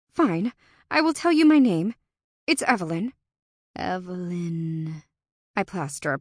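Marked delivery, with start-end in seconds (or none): 0.9-3.1 raised, sharp and higher; loud retort. A narrator's reading. Evelyn retorts loudly. 3.7-5.0 slow drawn fae voice. A narrator's reading. Fae repeats name slowly.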